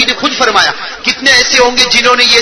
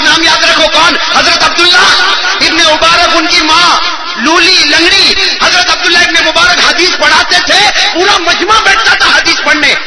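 raised, high-pitched impassioned storytelling; full-force preaching; 8 semitones higher, 3 dB louder than usual. Real, not acted. Preacher telling of a lame mother's wish, impassioned preaching. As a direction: exhort the crowd, driving each phrase home.

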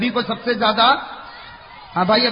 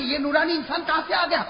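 ordinary talk, nothing marked

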